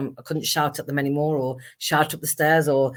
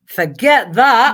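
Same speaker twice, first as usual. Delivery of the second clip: higher, louder and slow impatient refusal; defiant outburst; 8 semitones higher, 10 dB louder, much slower than usual. Retold or imitated. Her younger self refusing to wait years, loud defiant outburst. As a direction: fling it away like an impatient teenager. Teen self rejecting waiting until forty-two.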